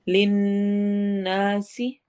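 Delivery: लिन्नासी very slow, the nn held and nasal; slow Quran recitation of लिन्नासी; nasal ghunna. Shown as a demonstration. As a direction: hum the nun in लिन्नासी. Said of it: "लिन नून पर गुन्ना है".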